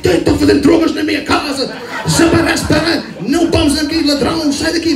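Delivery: hammed-up Portuguese dad; imitating dad in Portuguese. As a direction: rant like an angry Portuguese father. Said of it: "I was like".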